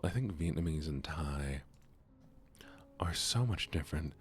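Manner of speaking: normal talking voice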